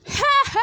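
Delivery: sharp high yelp of alarm; high cry of shock. Retold or imitated. A wife cries out on learning the betrayal.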